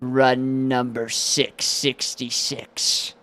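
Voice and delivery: slow, hushed, sinister announcement; mad scientist. Acted. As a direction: intone it like a dark ritual. Ominous announcement repeated.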